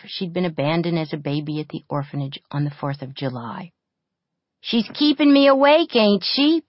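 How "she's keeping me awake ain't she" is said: high, loud angry snap; snapping back. A narrator's reading. Bully snaps at the girl who defends the kid, high and loud. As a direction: bite back at her. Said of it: "Pepper snapped back at July".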